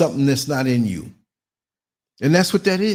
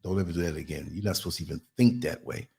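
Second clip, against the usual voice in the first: stern father quietly; 10 semitones lower, 9 dB quieter than usual. Retold or imitated. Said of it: "he was like".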